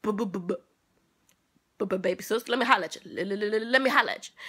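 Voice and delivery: mock stuttering big sister; mild imitation of a sister stuttering while mad; imitating a stutter. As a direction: stammer out each start like an angry older sister. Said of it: "she used to stutter".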